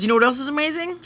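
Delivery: high put-on segue voice; mock segue voice. Cohost turns topic to mushrooms, high put-on teasing voice. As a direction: tease the next topic like a host.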